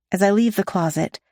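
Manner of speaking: usual speaking voice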